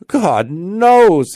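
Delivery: high, loud outburst of bewilderment; exclaiming at wit's end. A narrator's reading. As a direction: throw it out desperately. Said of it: "exclaimed he, at his wit's end".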